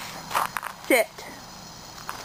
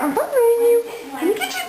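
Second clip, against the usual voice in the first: high and louder playful tease; playful chase voice; 13 semitones higher, 4 dB louder, faster than usual. Real, not acted. Owner chasing the puppy, high playful tease.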